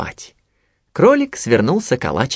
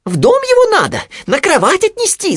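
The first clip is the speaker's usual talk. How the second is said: very high squeaky little piglet, sudden realization; Хрюня; 4 dB louder than usual. A narrator's reading. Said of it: "спохватился Хрюня". (высокий писклявый голос поросёнка, спохватившись)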